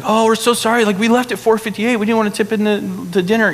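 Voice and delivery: high-pitched mock panic apology; going nuts. Upset kid apologizing at the restaurant, imitated high and frantic. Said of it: "my kid is going nuts".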